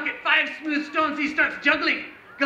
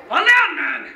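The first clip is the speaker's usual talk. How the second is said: loud, high stage order; shouted command; 5 semitones higher, 5 dB louder than usual. Pretend. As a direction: bark it at your slow stage partner. Ordering helper to fall.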